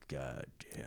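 low, very soft grumbled curse; muttered curse. Retold or imitated. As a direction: swear under your breath, annoyed. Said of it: "He's like".

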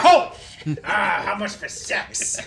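high shout, then slow growl; loud gung-ho soldier. Acted. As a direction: bark it like a fired-up colonel. Pacino coach impression.

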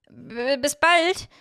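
high, slow, stuttered first sound; stammered goodbye. A narrator's reading. Miorin saying goodbye to Hiro, flustered stammer. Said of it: "stammelte ich".

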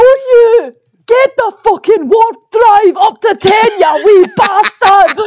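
high, loud, slow Glaswegian rant; hammed-up sweary Scot roaring in thick Glaswegian; aggressive Glaswegian. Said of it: "much more Glaswegian ... proper aggressive".

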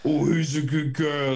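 sweet pet-talk coo; dog voice. Shown as a demonstration. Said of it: "it's your dog voice".

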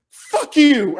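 loud, high snarled curse; imitating a rude customer's outburst. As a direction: spit it at the cashier. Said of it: "And he was like".

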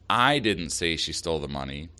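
"I" punched at the front, rest unstressed; stress on I. Shown as a demonstration. Host showing listeners how emphasis changes meaning, stress on "I". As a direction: lean hard on "I", then let the rest fall away. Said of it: "emphasizing one word in that sentence".

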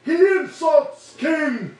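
slow, high, shouted giant voice; shouting fire giant. Acted. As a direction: bellow it in broken speech. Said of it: "You hear one distinct voice say".

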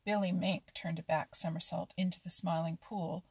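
plain reading voice

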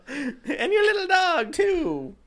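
high, slow shrieking witch line; cackling witch voice. Acted. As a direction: screech it like the Wicked Witch. Wicked Witch quote, shrieked.

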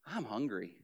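low, soft, put-upon sigh; fishing for attention. Retold or imitated. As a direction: complain softly so they'll ask why. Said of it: "It's like".

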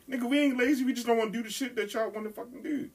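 indignant high-pitched retort; fussing at the radio. Retold or imitated. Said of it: "I was fussing at the radio".

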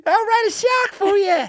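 very high squeaky Aussie; mock Australian accent. Co-host joining the impression of the listener, squeaky mock Australian. That's what this guy sounds like.

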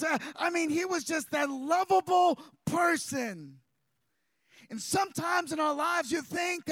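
loud, high, driving sermon delivery with punched phrases; full-force preaching. Real, not acted. Preacher telling the congregation they may question God, impassioned full-force preaching. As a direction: preach it hard, building to an impassioned cry.

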